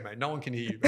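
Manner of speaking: quoted through laughter; laughing producer quote. Retold or imitated. Host quoting producer Pierre, laughing. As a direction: crack up while quoting him. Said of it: "He's going".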